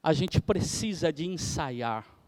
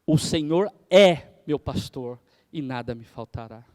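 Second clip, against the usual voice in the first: "O Senhor é meu pastor e nada me faltará" said low with stress on "é"; one lower, steady phrase leaning on "é"; a flowing take that rests on "é"; intonation demo; 6 semitones lower, 3 dB louder than usual. Shown as a demonstration. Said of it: "Cada entonação dessa".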